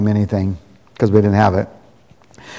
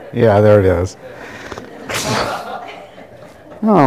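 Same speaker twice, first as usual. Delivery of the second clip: ordinary delivery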